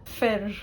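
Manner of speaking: Fir ending in a slender r. Shown as a demonstration. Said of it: "with the I is fir".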